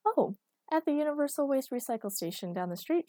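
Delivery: breezy and cheerful about the junk yard; cheerily. A narrator's reading. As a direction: say it as if it's perfectly normal. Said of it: "she said cheerily".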